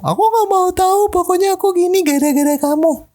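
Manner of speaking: mock the girl who won't take the blame; squeaky high girlfriend impression; whiny girlfriend voice. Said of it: "Itu cewek banget gak sih?".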